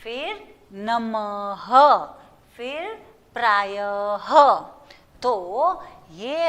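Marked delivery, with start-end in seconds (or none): 0.7-1.7 नमः slowly as "na-ma-ha"; slow syllable-by-syllable model of नमः; sounded final h. Shown as a demonstration. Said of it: "त के बाद ह बोलना है". 3.4-4.3 प्रायः as "praa-ya-ha", lower in pitch; syllable-by-syllable model of प्रायः; sounded final h. Shown as a demonstration. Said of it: "त के बाद ह बोलना है".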